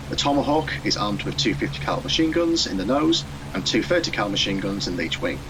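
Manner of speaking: usual speaking voice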